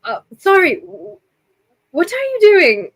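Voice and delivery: high, alarmed "what are you doing?"; startled NPC. Acted. Noble catches Kanari rummaging in his pack, startled and high. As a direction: caught off guard as his pack is searched. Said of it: "and he goes".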